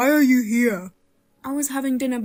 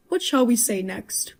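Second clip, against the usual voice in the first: ordinary delivery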